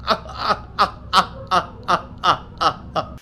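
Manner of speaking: airy breathy laugh; demo of real laugh. Shown as a demonstration. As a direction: show how you truly laugh. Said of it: "here's how I laugh".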